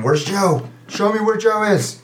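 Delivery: high, strained cry without full volume; mock screaming. Game master voices captive dragged into a cave, mock screaming. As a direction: act out a captive's frantic screaming. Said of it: "he's just screaming".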